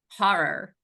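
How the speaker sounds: put-on HORROR as HAH-rer in a regional accent; speaker's regional accent. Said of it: "I said it with my accent".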